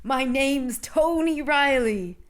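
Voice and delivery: slow, high and loud, drawn-out Walken voice; a ghostly narrator in a put-on Walken cadence; Christopher Walken impression. Podcaster mimics the film's dead narrator, slow hammed-up Walken. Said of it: "this is Christopher Walken".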